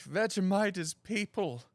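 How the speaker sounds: high, slow horror-movie reveal; dramatic reveal. Pretend. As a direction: announce it like a shocking twist. Soylent Green parody line.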